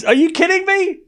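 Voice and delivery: high, exasperated are you kidding me; mock-outraged at chat; squeaky incredulous protest; disbelief. Real, not acted. Arguing witches are villains with chat.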